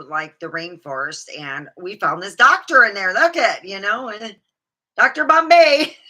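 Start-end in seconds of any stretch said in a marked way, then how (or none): 5.0-6.1 louder, higher name through a laugh. Real, not acted. Joking about the cheap rainforest doctor, laughing. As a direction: crack up on the name.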